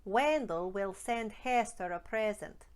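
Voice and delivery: slow, stretching the eh in "Wendell", "send", "Hester"; a slow Scottish drill sentence; Scottish long eh. Shown as a demonstration. Coach drills a sentence with the long eh. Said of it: "Shall we try a few more?".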